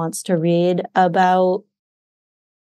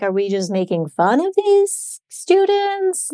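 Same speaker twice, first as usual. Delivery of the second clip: a slow, higher, lightly puzzled retold thought; mock puzzled question; 8 semitones higher, slower than usual. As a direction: wonder aloud, half-amused and a bit baffled. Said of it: "you're just like".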